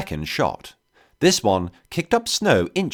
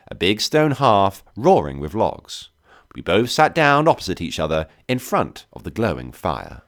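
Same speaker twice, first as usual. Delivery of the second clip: ordinary delivery, nothing marked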